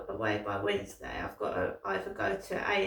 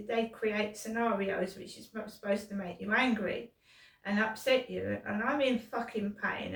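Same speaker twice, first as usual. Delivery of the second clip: normal talking voice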